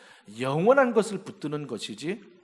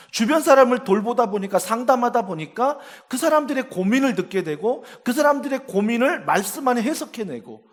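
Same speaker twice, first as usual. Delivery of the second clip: higher, louder, driving sermon climax; preaching at full force; 8 semitones higher, 7 dB louder than usual. Real, not acted. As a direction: build the point like a fiery preacher.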